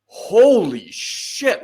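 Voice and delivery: high, slow, drawn-out amazement; a dawning, stretched-out holy shit; stunned realization. Retold or imitated. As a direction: let the realization hit you slowly. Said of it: "And I was just like".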